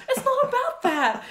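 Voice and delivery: high, loud retold outburst; exasperated mock protest. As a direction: act out arguing back at the cousins. Acts out arguing back at cousins.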